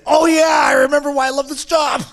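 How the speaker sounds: high, loud joyful cry with laughter; ecstatic shout. Retold or imitated. Shaman recalling the moment the medicine hits, joyful shout. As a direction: shout your joy out.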